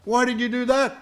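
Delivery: high, loud, outraged question; indignant protest. Acted. Bitten camel confronting the scorpion, loud indignant cry. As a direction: demand an answer, voice pitched right up.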